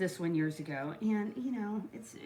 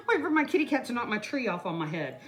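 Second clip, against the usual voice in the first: ordinary talk, nothing marked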